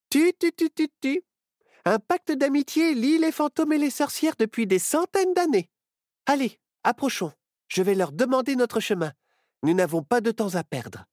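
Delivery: put-on high bright girl's voice; young witch girl. A narrator's reading. Myrtille cheering on her friend, high girl's voice.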